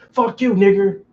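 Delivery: sudden loud, high sneering jab; mock hateful insult. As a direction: spit it out like a bigot taunting. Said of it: "mock bigot insult".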